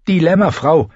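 handmaid Iri. A narrator's reading. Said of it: "sagte Iri".

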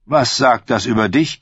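ordinary delivery, nothing marked

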